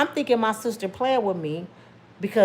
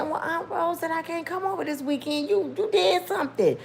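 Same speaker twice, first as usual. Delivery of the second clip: put-on higher, small childlike voice; little girl explaining, voice raised; young girl voice; 7 semitones higher than usual. Young [name] quoting herself to cousin.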